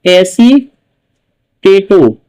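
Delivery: एसीटेटो stretched very slowly, syllable by syllable; drawn-out model word. Shown as a demonstration. Teacher drills the ligand name for students, very drawn out. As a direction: say एसीटेटो slowly for the class to repeat. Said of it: "क्या कहेंगे आपका?".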